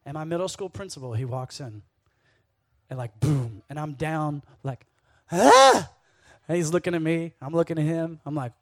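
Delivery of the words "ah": loud, high gasp of shock; sudden startled "Ah!" burst out; startled yelp. Retold or imitated. Kid caught stealing by the principal, startled yelp.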